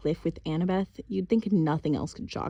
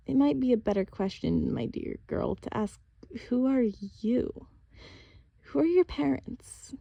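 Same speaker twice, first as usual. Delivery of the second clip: softened; 6 semitones higher than usual. A narrator's reading. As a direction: ease the girl toward the truth. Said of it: "Artemis's expression softened".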